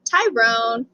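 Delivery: usual speaking voice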